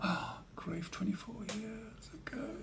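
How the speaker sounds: gasp, then whisper it in disbelief; breathless, stunned whisper of realisation; shocked whisper. Real, not acted. Shock at how long ago.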